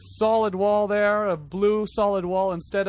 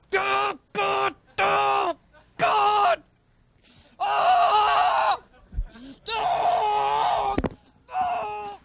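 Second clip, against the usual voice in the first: anguished wailing cries; 13 semitones higher, much slower than usual. Pretend.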